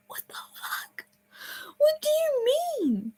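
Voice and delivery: whispered shock jumping to high squeak; shocked disbelief. Retold or imitated. Camper falsely accused in front of the cabin, shocked disbelief. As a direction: start in a whisper, then go very high, aghast.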